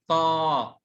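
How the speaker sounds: طا with the alif held long; long vowel. Shown as a demonstration. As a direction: stretch out the "aa" in طا. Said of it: "مد بالألف".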